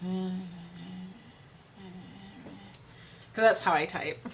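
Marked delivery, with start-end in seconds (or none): 0.0-2.7 slow, much softer, fading meh sounds; bored mock meh. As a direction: drone it out, dying away. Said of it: "still just kinda like".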